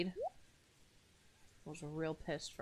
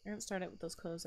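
ordinary talk

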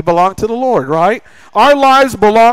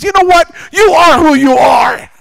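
high, raised-voice retelling of an exasperated outburst; mock exasperated telling-off; upset; 8 semitones higher than usual. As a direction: snap it out high, imitating a fed-up man. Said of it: "Deacon Gary got so upset".

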